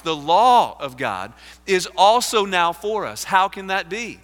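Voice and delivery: loud, building oratory with weighted phrases; impassioned preaching. Acted. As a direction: proclaim it to the whole congregation. Preaches his summary with force.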